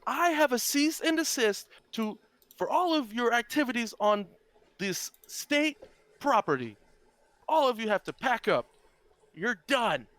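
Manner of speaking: high, loud, officious woman; high-pitched woman's voice. Acted. Woman serving a cease and desist, high-pitched voice. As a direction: announce it high and loud, laying down the law. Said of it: "in a high-pitched woman's voice".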